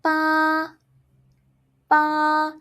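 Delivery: "pa" like ba, no breath puff, high and level; unaspirated first tone. Shown as a demonstration. Teacher models pinyin ba, "pa" unblown, high and level. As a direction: hold "pa" high without blowing air. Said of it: "you say it as".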